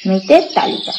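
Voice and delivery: ordinary delivery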